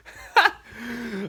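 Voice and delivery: high, bright burst of real laughter. Real, not acted. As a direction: crack up at the memory. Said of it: "I was fucking laughing so hard".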